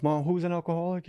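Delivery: fast, questioning Australian lilt; Australian healer guessing lightly; Australian accent. Retold or imitated. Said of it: "he's Australian".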